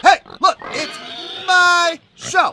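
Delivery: loud, high mock-stubborn insistence; playful defiance. Insisting to viewers he'll replay the song, hammed-up defiant.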